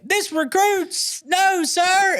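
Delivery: very high, strained mock recruit yell; screaming recruit. Terrified recruit answering a drill instructor, high mock scream. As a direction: imitate a recruit screaming his answer, drawn out.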